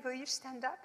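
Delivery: ordinary talk, nothing marked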